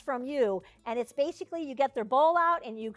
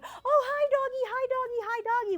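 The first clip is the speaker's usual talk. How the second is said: high-pitched doggy greeting in a rapid singsong; hammed-up, high cooing at a dog; gushing Walmart stranger; 11 semitones higher than usual. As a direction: squeal over a dog like an overexcited stranger. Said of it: "the person in Walmart that's going".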